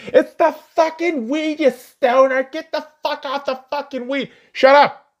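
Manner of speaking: high loud nagging voice; mock scolding self. Berating his stoner self, high nagging voice. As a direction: nag at yourself in a high put-on voice.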